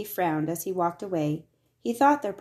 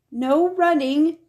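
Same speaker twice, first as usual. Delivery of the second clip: louder, higher, slow warning; a reminder even with a broken arm; calling after; 9 semitones higher, 8 dB louder, slower than usual. A narrator's reading. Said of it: "Merriweather called after him".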